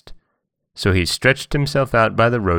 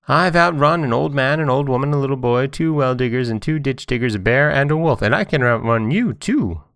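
higher-pitched sing-song runaway cake; high, bragging little character voice; boastful Johnny Cake; 7 semitones higher than usual. A narrator's reading. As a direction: rattle off the list as a cheeky boast. Johnny Cake brags to the fox.

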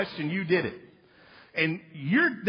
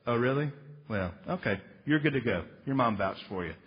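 mock lenient judge; 8 semitones lower, 4 dB quieter than usual. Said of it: "The judge is not gonna go".

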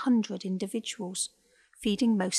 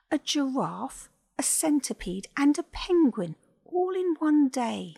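amazed list; 6 semitones higher, 4 dB louder than usual. A narrator's reading. Ava lists odd patients.

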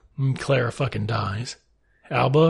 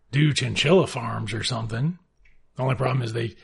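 ordinary delivery

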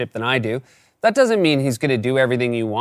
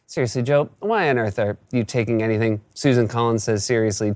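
usual speaking voice

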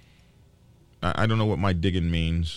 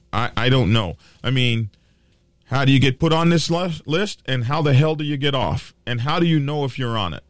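louder, higher angry questions; louder rant about getting off the list; frustrated outburst; 5 semitones higher, 7 dB louder than usual. Real, not acted. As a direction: demand answers, heated.

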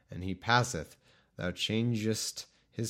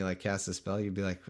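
ordinary delivery

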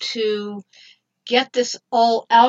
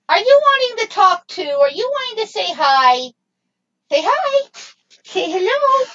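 much higher, louder sing-song coaxing; 11 semitones higher, 6 dB louder than usual. Real, not acted. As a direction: coax a pet in a squeaky voice. Coaxing the dog to say hi.

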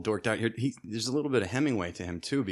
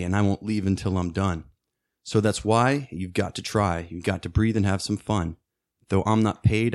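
lyric reading. Shown as a demonstration. Guest reading the lyric verse to hosts, measured recital. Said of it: "read this first verse for us".

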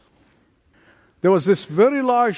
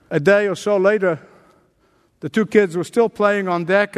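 normal talking voice

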